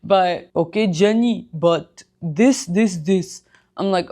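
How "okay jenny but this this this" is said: lightly voiced Middle Eastern accent of a dad; a hint of dad's Middle Eastern accent. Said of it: "with his accent".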